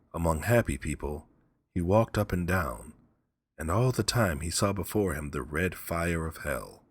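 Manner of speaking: ordinary delivery, nothing marked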